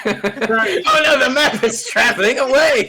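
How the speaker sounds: high, loud cry through laughter; laughing alarm. Real, not acted. Player watching the map slide away, loud laughing alarm. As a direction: wail in amused panic, laughing.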